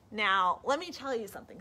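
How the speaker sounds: usual speaking voice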